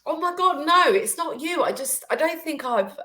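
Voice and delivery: fighting back tears while reassuring; emotional. Real, not acted. Reassuring the host while getting emotional, voice cracking high. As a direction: voice jumping high, choked with feeling. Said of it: "I'm just getting emotional".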